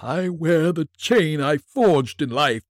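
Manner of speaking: slow, high mournful ghost; unearthly voice. Acted. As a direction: intone like a chained spirit.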